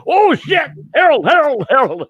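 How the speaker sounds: high, loud panicked yell; frantic high-pitched holler; alarmed calling out. Acted. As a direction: yell his name after him in a panic, pitch way up. Alarmed yell after runaway Harold.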